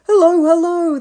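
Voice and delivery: high, loud greeting; children calling hello. A narrator's reading. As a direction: call out while running up. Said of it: "they called".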